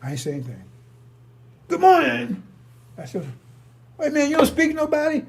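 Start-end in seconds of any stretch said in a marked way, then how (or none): 1.7-2.4 high, loud sing-song good morning; mock cheery greeting. Mimicking man's loud greeting. 4.0-5.2 high, loud indignant challenge; retold indignant retort. Retold heated exchange.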